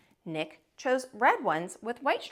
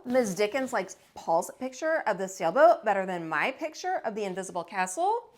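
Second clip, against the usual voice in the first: ordinary delivery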